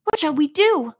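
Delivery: narrated panic, high and urgent; panicked. A narrator's reading. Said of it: "he asked in panic".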